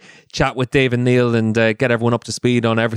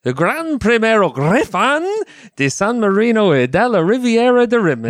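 hammed-up Italian accent; 10 semitones higher than usual. Shown as a demonstration. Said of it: "Don't forget the accent".

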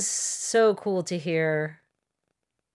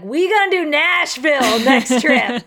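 loud, higher-pitched hype; rally the girlfriends, big and loud; excited retold announcement; 6 semitones higher, 6 dB louder than usual. Telling girlfriends about a Nashville trip, excited and loud. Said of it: "I already told a bunch of girlfriends".

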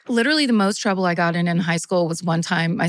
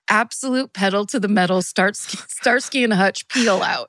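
ordinary delivery

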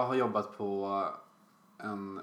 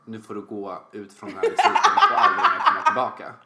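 mock banishing order; 11 dB louder, faster than usual. Retelling banning a customer from the store, laughing mock order. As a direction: play the shopkeeper kicking someone out, cracking up.

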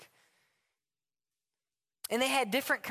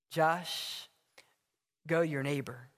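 lower, gentle divine correction; calm voice of God; 4 semitones lower than usual. Acted. God tells Josh to go to his neighbor, calm and lower. Acted dialogue, God answering.

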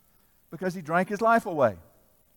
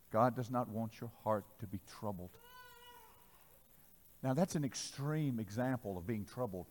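low, soft and earnest; hushed earnest; 7 semitones lower, 9 dB quieter than usual. Real, not acted. Preacher confiding in congregation, soft and low. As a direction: draw them in quietly.

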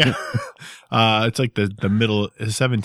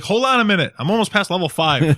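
mock busy gamer; 10 semitones higher than usual. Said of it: "I'll be like".

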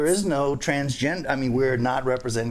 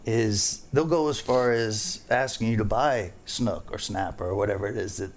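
ordinary talk

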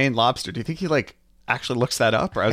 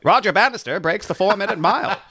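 1950s narrator; 9 semitones higher, 4 dB louder than usual. Pretend. Newsreel bit continues.

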